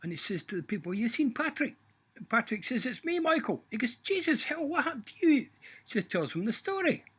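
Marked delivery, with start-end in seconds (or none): 0.9-1.7 much higher, loud query; squeaky voice for Michael; high voice asking at the pub; Michael's voice. Acted. 2.9-3.6 much higher cheerful reply; high-voiced Patrick answering his friend. 4.0-5.5 much higher, louder astonished cry; shocked disbelief; Michael stunned to see Patrick straight. Acted.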